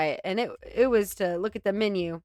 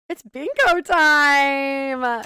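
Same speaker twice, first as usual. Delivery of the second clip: slow, louder, higher game-show call; mock announcer; 4 semitones higher, 5 dB louder, much slower than usual. Commentator introducing the bingo clip, slow hammed-up announcer. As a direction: stretch it out like a bingo host.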